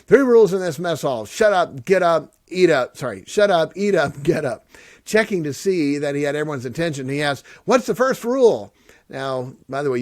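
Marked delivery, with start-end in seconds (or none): 0.1-4.2 loud, higher barked rules; drill rules barked. Retold or imitated. 7.6-8.7 high, loud barked question; drill instructor question. Retold or imitated. Instructor quizzes recruits.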